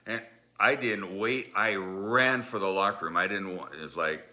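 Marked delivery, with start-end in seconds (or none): none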